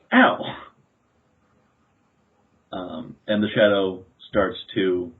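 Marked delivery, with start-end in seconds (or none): 0.1-0.6 sudden high-pitched yelp of pain; yelled ow. Acted. Shadow boy trodden on by a runner, yelping. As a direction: yelp as if stepped on. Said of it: "it actually yells out".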